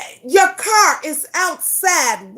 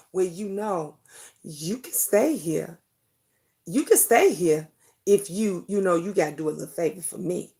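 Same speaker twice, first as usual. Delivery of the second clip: a lower, softer, coaxing man's voice; mock smooth-talking man; 9 semitones lower, 7 dB quieter than usual. Retelling the friend's man, a low, hushed come-on. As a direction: play the man sliding in a sleazy offer, low and soft.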